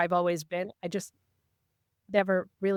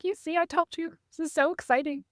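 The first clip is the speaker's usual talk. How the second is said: high-pitched, mock-excited retelling; excitedly imitating your own reaction; retold excitement; 9 semitones higher than usual. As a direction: gush it as a retold I-told-you-so. Telling husband her excited news.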